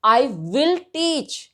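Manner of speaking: slow, with "will" pressed hard; teacher stressing will; emphatic will. Shown as a demonstration. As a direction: lean on will to show resolve. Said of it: "emphasise करके बात को बोलना है".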